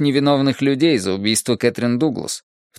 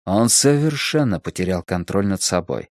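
ordinary delivery

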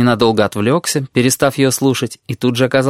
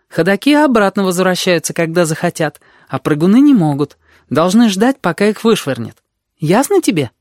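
put-on higher-pitched girl lecturing; teacherly tone; 7 semitones higher than usual. A narrator's reading. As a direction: explain it like a schoolteacher. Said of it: "сказала она учительским тоном".

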